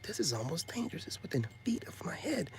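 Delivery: breathy unvoiced whisper of alarm; hushed worried aside. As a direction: whisper it to yourself, uneasy. Whispered inner thought about danger.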